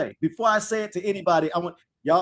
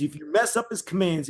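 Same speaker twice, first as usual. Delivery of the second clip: ordinary talk, nothing marked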